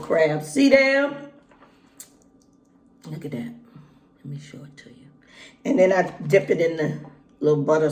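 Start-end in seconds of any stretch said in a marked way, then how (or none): 3.1-3.5 hushed. Real, not acted. Showing the crab up close. 4.2-5.1 soft unvoiced whisper. Real, not acted. Showing the crab up close.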